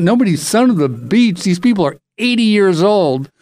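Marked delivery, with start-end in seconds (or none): none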